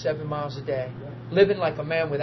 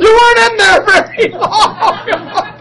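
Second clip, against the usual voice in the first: slow, high, loud line through laughter; wife's suspicion, voiced while cracking up; loud laughing shout; 13 dB louder, slower than usual. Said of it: "she's like".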